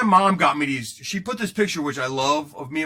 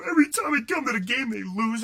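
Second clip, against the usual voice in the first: higher-pitched imitation of a cranky kid; cranky teenager; 7 semitones higher than usual. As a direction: whine it like a cranky young fan. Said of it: "that were so cranky".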